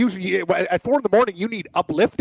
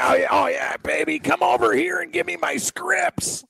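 mock creep; 9 semitones higher than usual. Mocking a creepy harasser.

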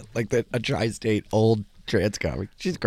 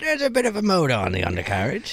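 a higher, put-on British drag voice; 6 semitones higher, faster than usual. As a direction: voice a cheeky old dame. Mimicking Dame Edna's British voice.

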